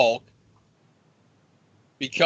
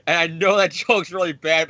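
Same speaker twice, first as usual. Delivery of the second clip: ordinary delivery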